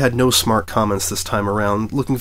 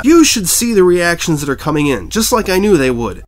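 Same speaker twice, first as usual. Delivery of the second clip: ranting; 8 semitones higher, 7 dB louder than usual. A narrator's reading. Editor ranting at reporter.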